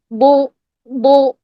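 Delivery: "بو" slow, as a heavy back Doo; mouth-full Dad. Shown as a demonstration. Teacher modelling for students, "بو" slow and full-mouthed. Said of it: "منہ بھر کے".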